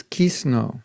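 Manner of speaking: Kisno said with K and S, no SH, ending in O; Bengali Kisno. Shown as a demonstration. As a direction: pronounce Kisno the Bengali way, open O at the end. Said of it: "If you go to Bengal, it's Kisno".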